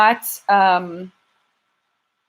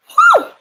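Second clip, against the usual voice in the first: whew; 8 dB louder than usual. Real, not acted. Relief after reading chats.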